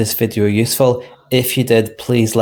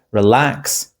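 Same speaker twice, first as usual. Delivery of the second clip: "relax" with stress on LAX; re-LAX, first syllable left weak; stress on second syllable; 3 semitones higher, 5 dB louder than usual. Shown as a demonstration. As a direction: lean on the end of "relax". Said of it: "we stress the second syllable".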